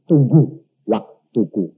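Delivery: low, slow divine reply; God calmly telling him to wait; solemn God voice. Retold or imitated. God answers, wait my time.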